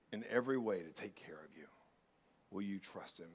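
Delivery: unvoiced whisper of reassurance; whispered appeal. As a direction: whisper it to the congregation.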